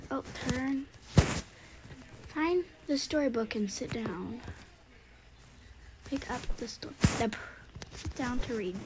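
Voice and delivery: normal talking voice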